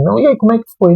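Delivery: a hint of an expectant parent asking; imitated questioning parent. Parent greeting the student back home, lightly imitated questioning. Parent asks how class went.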